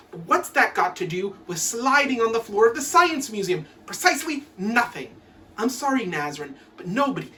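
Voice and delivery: normal talking voice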